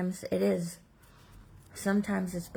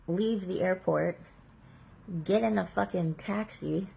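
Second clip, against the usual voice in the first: ordinary delivery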